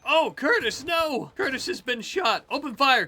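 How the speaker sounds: high, loud cry of alarm as a colonist goes down; alarmed shout. Real, not acted. Gamer shouting when his colonist Curtis is shot, alarmed. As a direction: yell out in real panic. Squad member shot in firefight.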